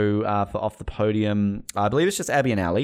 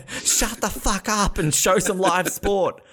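mock fan rant; 10 semitones higher, slower than usual. Pretends to read an angry fan message.

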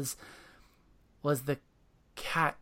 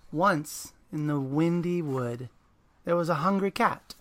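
put-on reading to children; 3 dB louder than usual. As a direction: read it like a bedtime story. Reads picture book aloud.

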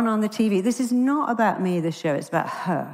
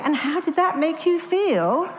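higher, exaggerated therapist singsong; mocking psychiatrist question, pitched up; 9 semitones higher than usual. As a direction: ham up the clueless therapist's stock question. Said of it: "Which is really psychiatrist".

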